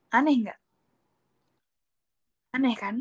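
ordinary delivery

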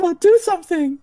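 high, urgent woman's voice; pleading woman. Acted. GM voicing Lenin begging the player to do something, high and urgent. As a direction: push him to act, pitched high.

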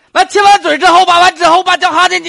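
high, loud, worked-up blurting; loud excited. Real, not acted. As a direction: shout it out carried away.

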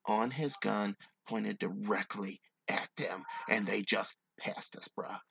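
low, measured storytelling read; hushed-down, deliberate campfire-tale delivery; story narration. A narrator's reading. Reads a story aloud.

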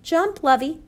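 high, loud urging; urgent command. A narrator's reading. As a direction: call the child to leap now. Mother urges child to jump.

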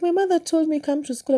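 put-on high, innocent child's voice. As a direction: answer simply like a small child. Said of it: "She's like".

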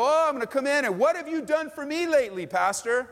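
demanding what the pastor has done, hammed-up; entitled demand. Entitled member confronting the pastor, mockingly demanding. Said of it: "And you go".

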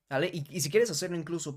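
ordinary talk

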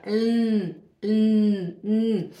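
"n" hummed long with the tongue in place; "N" as a pure held consonant, no vowel; held N sound. Shown as a demonstration. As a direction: sustain a nasal "nnn" alone. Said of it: "a língua do N aqui".

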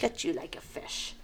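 very soft whispered menace; mobbish threat. Acted. Host relishing the store threat, whispered mobbish menace. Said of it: "I'm very mobbish right now".

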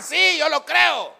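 fervent preacher's shout; shouted affirmation. Shown as a demonstration. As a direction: declare belief at high volume. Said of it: "Tiene que gritar".

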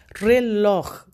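reloj with a strong second syllable; re-LOJ, the last syllable stressed. Shown as a demonstration. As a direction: say "Reloj" as an example, hitting LOJ. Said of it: "La sílaba más fuerte de reloj".

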